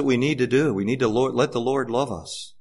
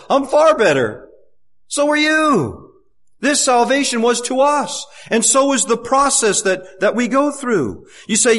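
rising, louder preaching at full force; fervent preaching; 6 semitones higher, 3 dB louder than usual. Acted. Preacher urging the congregation, rising to full force. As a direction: preach it with building urgency.

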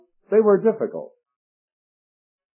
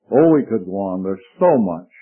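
ordinary talk

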